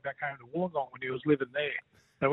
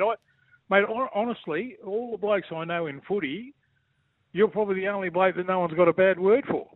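ordinary talk, nothing marked

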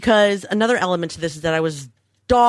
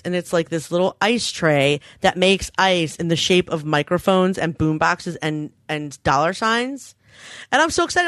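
usual speaking voice

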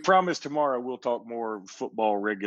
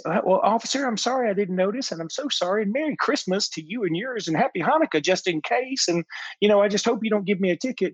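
higher pleading sugary voice for the officer; sweet-talking mom; 9 semitones higher than usual. Retold or imitated. Pleading Southern mom sweet-talking the officer, raised pitch. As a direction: mom sweet-talks a cop out of a ticket, much higher. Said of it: "she said".

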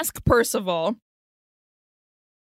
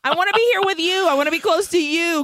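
high, fast imitation of a clingy woman; mock clingy pleading; 5 semitones higher, faster than usual. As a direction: plead like a lovesick girl, hammed up. Acts out clingy Elizabeth in translation.

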